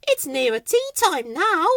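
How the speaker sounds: high sing-song character voice; Weed. A narrator's reading. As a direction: pipe it up high. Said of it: "she said".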